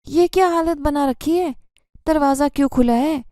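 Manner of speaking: sharp furious stepmother demand; angry shout. A narrator's reading. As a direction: scold her furiously as you storm in. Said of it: "چیختی آئی اور غصے سے کہنے لگی".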